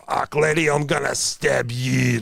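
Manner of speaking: menacing Scottish warrior growl; gruff Scottish dude. Retold or imitated. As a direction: play the big gruff Scottish brute. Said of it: "like the really gruff Scottish dudes".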